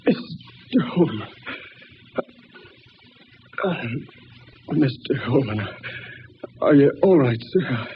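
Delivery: halting, broken-up speech after a crash; faltering, catching breath between phrases; dazed and shaken. Acted. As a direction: stammer it out in shock.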